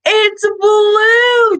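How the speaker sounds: high, slow gasp of wonder; mock amazement. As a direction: act amazed for little kids. Revealing tongue to kids.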